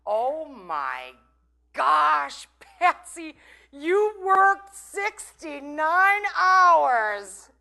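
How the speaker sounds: high, loud, very slow mock praise; mock eulogy voice. Mocking what mourners won't say at Patsy's funeral, high and loud. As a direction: drawl it like a gushing mourner. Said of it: "they're not gonna say".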